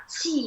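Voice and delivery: 气 said with a falling tone; falling fourth tone. Shown as a demonstration. Teacher shows the student 气 with falling tone. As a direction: drop the pitch through 气. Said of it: "the fourth tone".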